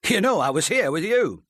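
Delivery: insistent. A narrator's reading. Husband defending alibi.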